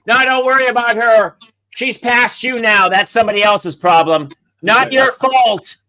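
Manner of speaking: loud, high mock reassurance; sarcastic yell. Comic parent heckling daughter's defense, sarcastic yell. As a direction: holler biting sarcasm from the sideline. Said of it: "I yell out, I go".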